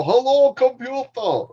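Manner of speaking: high mock Scottish "Hello, computer"; imitating Scottish accent. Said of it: "when you speak to the Scottish computer".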